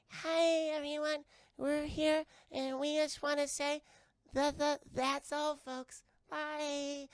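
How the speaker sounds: high, squeaky alien voice, slow at the catchphrase; little alien monster. Retold or imitated. Little alien greeting everyone with the catchphrase, high squeaky voice. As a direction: chirp it like a tiny cartoon alien. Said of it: "the little alien monster's like".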